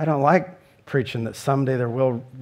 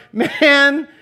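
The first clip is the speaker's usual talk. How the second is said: higher and louder, exasperated at the city; exasperated sigh of a line; 11 semitones higher, 7 dB louder than usual. Retold or imitated. Preacher voicing Jesus fed up with Jerusalem, loud exasperation. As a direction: throw up your hands at Jerusalem. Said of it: "he's just going".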